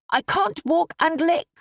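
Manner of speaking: put-on high, piping child's voice; little girl complaining. A narrator's reading. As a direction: protest as a small girl. Child Harriet voiced in story.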